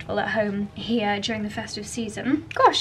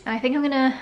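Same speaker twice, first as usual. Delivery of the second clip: ordinary talk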